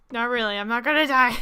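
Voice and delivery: loud burst through laughter; laughing. Real, not acted. Gamer taking back her dying joke, loud and laughing.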